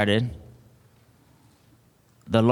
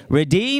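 REDEEM with a high rising second syllable; raised, drawn-up REDEEM, inviting repetition; call-and-response; 5 semitones higher than usual. Real, not acted. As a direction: call out "Redeem" for the crowd to echo, lifting high on "deem". Leading congregation to repeat words.

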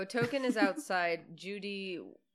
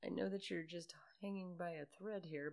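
whisper; 9 dB quieter than usual. Pretend. Coaxing the dangling character.

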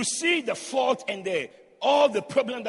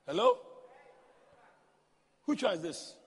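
disgusted sarcasm; 8 dB quieter than usual. Retold or imitated. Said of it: "said in disgust".

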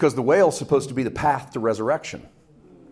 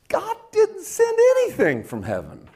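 much higher pitch, at normal volume; incredulous rebuttal. Real, not acted. Preacher correcting the servants' claim, high-pitched and incredulous. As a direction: pitch it way up in disbelief.

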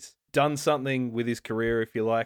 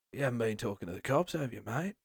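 quick, soft Aussie menace; fast, soft Australian threat; Australian tough guy; 6 dB quieter, faster than usual. Retold or imitated. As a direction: lean on them like an Aussie crook. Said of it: "Ben Mendelsohn doing his proper".

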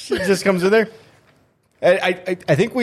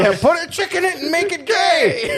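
much higher put-on cartoon executive; mock executive voice. Co-host parodying the Disney executive's order, hammed-up high voice. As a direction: chirp it out as a clueless studio boss. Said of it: "But the".

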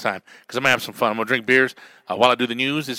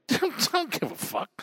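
laughing; 6 dB quieter, slower than usual. Real, not acted. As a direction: laugh through the line, high and slow. Laughing off criticism.

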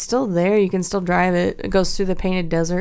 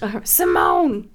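slow, drawn-out Pee-wee impression of the name; Pee-wee saying Simone; slower than usual. Fan imitating Pee-wee calling his friend, slow. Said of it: "I can hear him saying Simone".

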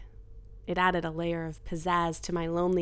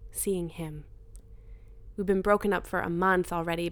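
usual speaking voice

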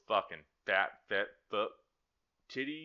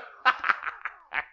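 breathy laugh; 6 dB louder than usual. Real, not acted. Laughing at Pooh photo.

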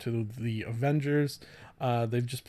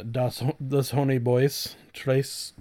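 usual speaking voice

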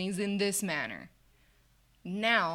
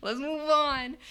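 louder, pushy, laughing impatience; loud laughing impatience, higher; impatient; 6 semitones higher, 7 dB louder than usual. Retold or imitated. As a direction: hurry her along with a laughing push. Impatient trainee voice retold.

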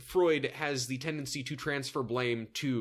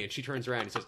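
ordinary talk, nothing marked

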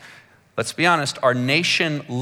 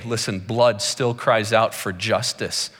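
ordinary delivery

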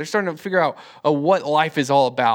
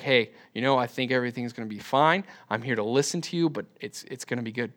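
soft, lower-pitched comforting voice; low-key, soothing talk, trailing softer; gentle reassurance; 5 semitones lower, 7 dB quieter, faster than usual. Acted. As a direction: reassure an upset kid quietly. Models reassuring a troubled kid.

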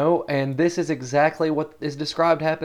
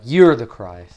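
bold blurted confession; 9 dB louder, slower than usual. Retold or imitated.

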